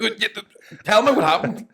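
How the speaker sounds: high exasperated laugh; laughing plea. Retold or imitated. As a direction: beg for the point.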